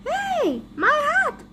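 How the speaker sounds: high-pitched indignant cry; alarmed call. Acted. Hat's owner calling after Dogman, alarmed. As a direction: call out sharply after a thief.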